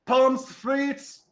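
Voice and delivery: high, loud North Country holler; little high-pitched cook calling out; tiny yelling kitchen voice. Acted. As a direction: yell the order as a tiny voice from the kitchen. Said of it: "this tiny little voice from the kitchen, North Country voice, yelling".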